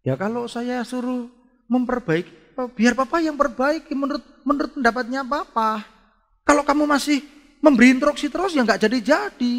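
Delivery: high-pitched, remonstrating dad; exasperated father. Acted. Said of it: "papanya ngomong". (suara bapak mengomel)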